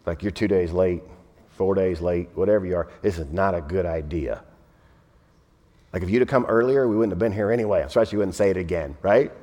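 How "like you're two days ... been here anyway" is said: low, softer exasperated Martha; lightly voiced exasperated sister; imitating Martha. Preacher imitating Martha scolding Jesus, low and exasperated. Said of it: "looking at Jesus and saying".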